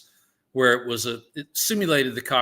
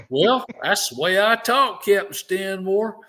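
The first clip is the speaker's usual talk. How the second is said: put-on Alabama accent; 9 semitones higher, 5 dB louder than usual. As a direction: answer the instructor the way you talked back home. Student answers instructor in old accent.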